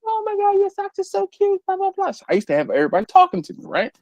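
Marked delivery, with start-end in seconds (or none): 0.0-2.1 very high mock girly squeal; exaggerated falsetto girl voice; gushing girls. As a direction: imitate schoolgirls gushing over something cute. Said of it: "all the girls were like".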